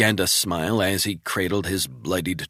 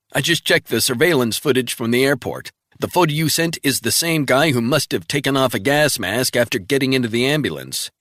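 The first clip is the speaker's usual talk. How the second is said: brisk, higher voice for the colleague; crisp agent reporting in; colleague on the phone; 6 semitones higher, 5 dB louder than usual. Acted. Travell briskly reporting in to Resnick by phone.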